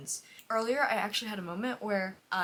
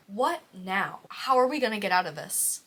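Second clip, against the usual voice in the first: ordinary talk, nothing marked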